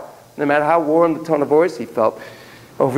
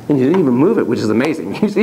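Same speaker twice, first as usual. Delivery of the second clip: ordinary talk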